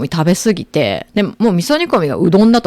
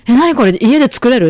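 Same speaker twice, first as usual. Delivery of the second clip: higher and louder, amazed at plain udon; amazed question; 5 semitones higher, 4 dB louder than usual. Retold or imitated. Teen discovering plain udon, amazed and louder. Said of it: "って言ったら".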